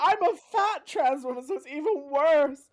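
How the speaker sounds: loud, very high-pitched self-mocking distress; upset. Real, not acted. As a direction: voice climbing to a high, strained squeal. Upset, self-mocking about her own body.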